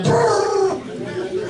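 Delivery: high rolled "brrr"; imitated trilled noise. Comic on explaining to a lawyer, trilled noise.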